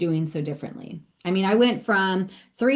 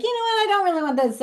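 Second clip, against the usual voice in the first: high-pitched mock whine of a mother wanting out; hammed-up high voice, backing out of giving birth; mock reluctant mother; 13 semitones higher, faster than usual. Woman mid-birth mocking her own reluctance, high whiny voice. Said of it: "and be like".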